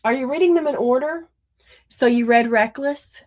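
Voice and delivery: ordinary delivery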